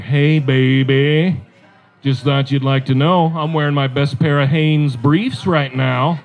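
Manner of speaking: low, slow husband's come-on; seductive mock whisper. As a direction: croon a drawn-out bad seduction. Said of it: "I whispered this into her".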